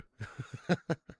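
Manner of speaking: snorting piggy squeal on request; pig noise. Pretend. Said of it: "That's the piggy noise you wanted".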